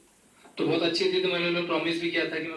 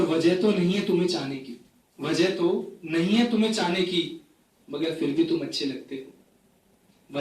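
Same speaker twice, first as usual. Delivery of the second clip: phrase-by-phrase mushaira delivery; poetry recitation; 5 dB louder than usual. Acted. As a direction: recite each couplet to the audience with pauses. Recites own poem to audience. (मुशायरे के अंदाज़ में कविता पाठ)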